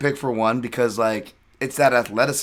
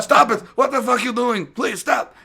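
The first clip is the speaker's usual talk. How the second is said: high-pitched imitation of someone begging; mock panicked pleading; 11 semitones higher than usual. Coach being tackled by a fighter, mock panicked begging.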